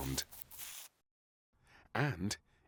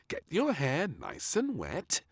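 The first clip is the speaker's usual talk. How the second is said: maid; 10 semitones higher than usual. A narrator's reading.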